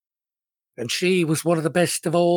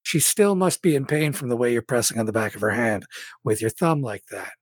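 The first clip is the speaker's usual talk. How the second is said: normal talking voice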